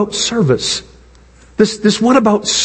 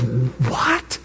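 stunned what; 10 semitones lower, 10 dB quieter than usual. Pretend. Roman's stunned reaction.

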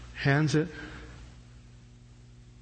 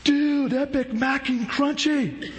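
much higher, slow, laughing surfer drawl; surfer dude; 11 semitones higher, slower than usual. Acted. The surfer dude disciple reacts to the bread like a stoked surfer. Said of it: "The surfer dude disciple says".

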